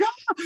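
very high sudden shriek of fright; startled shriek. Retold or imitated. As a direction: let out a short high scream. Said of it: "she just freaks out".

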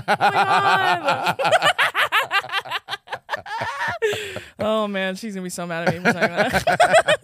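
real laughter through the words; giggly aftermath of a joke; laughing. Real, not acted. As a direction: talk while still cracking up.

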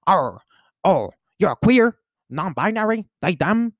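high, slow, badly faked Southern accent. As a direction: ham up a clumsy Southern accent. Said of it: "I cannot do a Southern accent".

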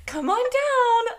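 high, slow, sing-song sweet talk; coaxing baby talk. Retold or imitated. Parents coaxing a trapped bird out, high cooing baby talk. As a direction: coo at it like a scared little pet.

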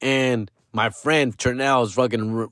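normal talking voice

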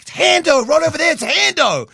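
high and loud, pointing him out; urgently convincing her he's there; insistent excitement. Retold or imitated. To his doubtful girlfriend, high excited insistence.

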